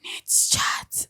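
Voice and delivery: seductive whisper. Pretend. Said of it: "That's my seductive voice".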